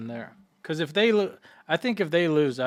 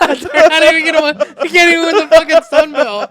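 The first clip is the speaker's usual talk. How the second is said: laughing; 13 semitones higher, 13 dB louder than usual. Real, not acted. Laughing mockery of the pick.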